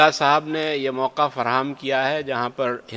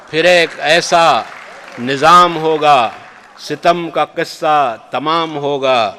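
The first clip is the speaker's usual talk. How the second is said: louder, slower, ringing declamation; emphatic recitation; 4 semitones higher, 8 dB louder, slower than usual. Acted. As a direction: build to a stirring climax. Repeated couplet at the climax.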